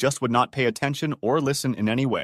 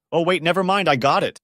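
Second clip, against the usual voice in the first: higher and louder, quick oh-wait; mock startled German-free schoolboy oops; sudden retraction; 6 semitones higher, 6 dB louder than usual. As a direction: blurt out the take-back. Student suddenly takes back question.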